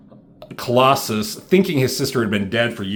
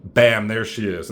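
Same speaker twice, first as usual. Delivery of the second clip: ordinary talk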